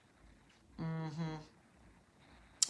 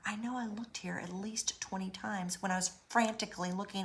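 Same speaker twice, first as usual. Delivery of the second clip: soft, half-whispered muttering; muttered to self; 6 dB quieter than usual. Retold or imitated. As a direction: whisper it to yourself in disbelief. Muttering to herself, bewildered.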